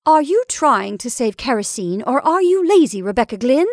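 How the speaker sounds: loud, rising accusation; indignant cry. A narrator's reading. Mrs Brigham scolding her sister over the lamp, indignant cry. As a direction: cry it out, climbing high and loud. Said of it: "cried Missus Brigham".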